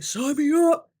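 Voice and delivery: high, excited squeal of eagerness; mock eager high voice; excited sign me up. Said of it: "I'm like".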